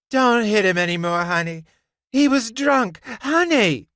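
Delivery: high, loud plea to stop; urgent pleading. A narrator's reading.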